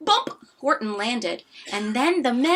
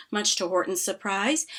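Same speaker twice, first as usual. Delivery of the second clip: usual speaking voice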